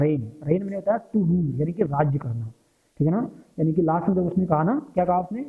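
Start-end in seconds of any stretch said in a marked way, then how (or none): 0.0-0.3 रेन said like rain; रेन, one syllable, no g; रेन, the spoken form of reign; correct pronunciation. Shown as a demonstration. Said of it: "इसका जो pronunciation है वो कैसे किया जाता है?".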